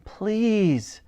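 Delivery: high, drawn-out little plea; pleading. A narrator's reading. Elsie turns to the Admiral, pleading.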